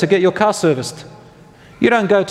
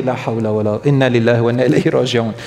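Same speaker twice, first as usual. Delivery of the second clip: lower, quick grieving Arabic phrase; grieving recitation; 5 semitones lower, faster than usual. Retold or imitated. Companion realizes he is accountable for the answer, grieving. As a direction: recite it low, as if struck by grief. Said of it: "It was a moment of grief".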